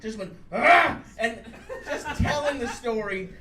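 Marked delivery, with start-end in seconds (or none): 0.5-1.0 loud, higher growl burst; imitated growl. Comic retelling of a friend's sex story, imitated growl. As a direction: growl it out suddenly. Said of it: "the guy growled at him".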